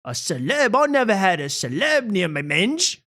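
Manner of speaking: cheeky posh English caricature; mock posh British. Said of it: "She doesn't talk like that".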